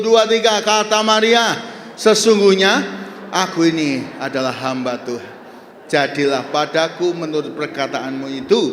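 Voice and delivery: rousing sermon delivery with call and response; preaching. Acted. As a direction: preach it to the congregation.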